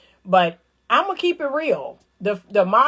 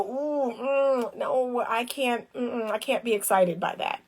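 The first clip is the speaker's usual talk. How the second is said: softer, drawn-out disapproving mm-mm; cooing turn-down, softer than her talk; soft turned-off refusal; 7 dB quieter than usual. Retold or imitated. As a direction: let him down softly, unimpressed. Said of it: "I go".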